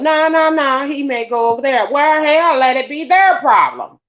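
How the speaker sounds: high-pitched, emphatic objection; cheeky protest. Retold or imitated. Refusing an elder's order to kill the snake, cheeky. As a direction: argue back without deference. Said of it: "I didn't say it like that".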